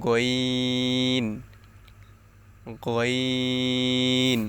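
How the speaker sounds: long, held গইন from the end of the throat; slow demonstration of throaty গইন; end-of-throat letter. Shown as a demonstration. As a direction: stretch গইন out slowly, made at the throat's end. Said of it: "গইন উচ্চারণ করতে হয়".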